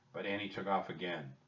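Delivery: usual speaking voice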